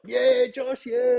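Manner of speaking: high, drawn-out team cheer; imitated cheering. Players cheer Josh after his speech, elated. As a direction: mimic the boys cheering you off.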